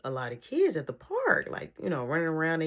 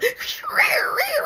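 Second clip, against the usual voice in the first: high, breathy burst of laughter; loud laughing burst; 7 dB louder than usual. Real, not acted. [name] teases about the two girls, a loud laughing burst. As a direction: explode into laughter.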